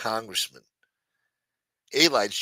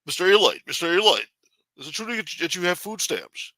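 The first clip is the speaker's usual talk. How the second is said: higher, pushy ambush questioning; Casperosa ambushing; 7 semitones higher than usual. Pretend. Casperosa popping up to harass the councilman, higher pushy voice. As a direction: corner him with the question, pitched up. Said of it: "Casperosa's gonna be popping out of nowhere".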